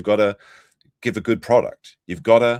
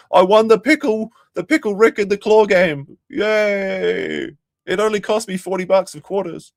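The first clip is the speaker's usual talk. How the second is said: much higher, louder, giddy mock voice with a silly put-on tone; mocking gleeful manchild; 10 semitones higher, 4 dB louder than usual. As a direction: ham up a childish claw-game winner bragging. Mocking Jeremy winning claw game.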